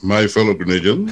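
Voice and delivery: booming mock orator, breaking into laughter; hammy thespian voice. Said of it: "go to my thespian voice".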